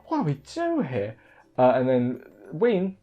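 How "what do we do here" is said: much higher and softer, panicked imitation; shook. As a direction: ask it helplessly, thrown off balance. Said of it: "they're both shook".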